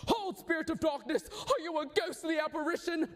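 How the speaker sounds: put-on a young prince crying out to a ghost. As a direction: call out boldly to the spirit. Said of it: "Young Hamlet cries".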